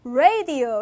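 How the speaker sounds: 라디오 as American "RAY-dee-oh"; English-style "radio"; American pronunciation. Shown as a demonstration. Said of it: "이렇게 읽는단 말이야".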